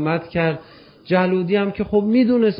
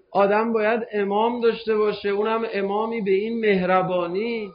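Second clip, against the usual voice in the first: ordinary delivery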